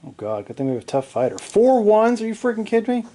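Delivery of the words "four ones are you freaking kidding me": high, incredulous outcry at the dice; can't believe the roll, half-shouting; outraged disbelief. Real, not acted. Gamer reacting to his team's four ones, high disbelief.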